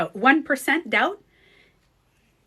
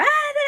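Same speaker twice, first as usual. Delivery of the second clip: sudden high-pitched "ah" of annoyance; short imitated cry of irritation; irritated yelp; 4 dB louder than usual. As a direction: burst out, exasperated by the noise. Irritated outburst at noisy kid.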